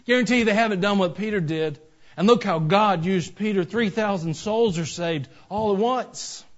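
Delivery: loud, high, emphatic pulpit delivery; forceful preaching. Real, not acted. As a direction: proclaim it to the whole congregation.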